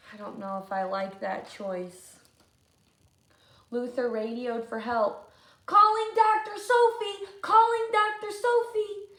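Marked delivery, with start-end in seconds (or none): 5.7-9.1 loud, high radio call. Acted. Luther radioing Doctor Sophie, loud and high. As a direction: call through the can phone.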